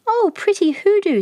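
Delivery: put-on a small boy admiring the shiny snake; delighted child. A narrator's reading. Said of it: "said Baba".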